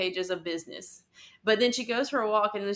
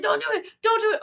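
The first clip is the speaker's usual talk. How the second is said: high, loud pleading don't do it; mock frantic warning to herself; urgent mock plea; 13 semitones higher, 7 dB louder, faster than usual. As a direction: beg under her breath not to open it, hammed up. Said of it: "she's like".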